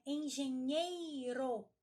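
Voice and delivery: "Engenheiro" with a long, loud "ei"; slow, dragged stress on the "ei" of "Engenheiro"; strong syllable drawn out. Shown as a demonstration. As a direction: lean hard on "nhei" in "Engenheiro". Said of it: "Estas sílabas são mais fortes".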